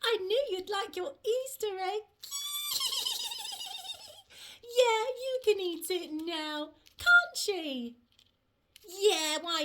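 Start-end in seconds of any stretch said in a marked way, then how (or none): 2.2-4.1 high, wavering little squeal; long fluttery high-pitched giggle-squeal; excited squeal. Acted. Butterfly thrilled with her Easter egg, excited squeal.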